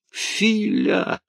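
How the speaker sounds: high, anguished call after him; plaintive cry. A narrator's reading. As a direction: cry his name out in longing. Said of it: "тоскуя, жалобно крикнул ему вслед".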